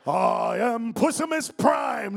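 slow, loud booming robot leader; robot hero. Pretend. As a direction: boom it like Optimus Prime. Parodying Optimus Prime speech.

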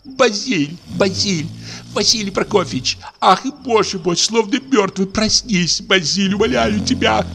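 high, urgent wife pleading to wake her husband; frightened wife. Acted. Wife wakes husband in panic.

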